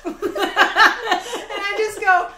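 high, slow, loud laughter through words; dissolving in giggles; laughing hard. Real, not acted. Telling the drive-thru story, laughing hard.

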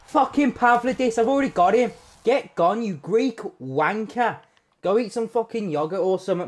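high, mocking rant at the card; mock outraged rant. Real, not acted. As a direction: vent at the duplicate. Duplicate player pulled.

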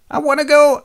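very high, loud sulky whine; whiny child. Acted. Tiresias whining to the king that he wants to leave. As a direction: whinge like a bored kid. Blind seer sulks like child.

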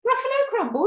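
high, loud shriek of alarm; hammed-up storybook horror; horrified monster. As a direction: echo it in panicked disbelief. Gruffalo repeats it in horror.

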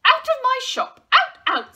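high, very loud outburst; comic outraged shouting; shouted dismissal. A narrator's reading. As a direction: yell him out of the shop. Raj throws Joe out.

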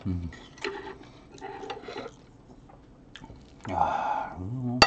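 humming while chewing; talking between bites; mouth full chewing. Real, not acted. Seen 5: Bites food at -7, cheeks full chewing through the hums.

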